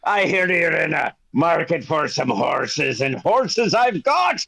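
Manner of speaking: a jovial dealer sizing up buyers; hearty horse trader. Acted. Horse dealer greets the party, a lightly voiced hearty salesman. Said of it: "Zach Jones comes out and he says".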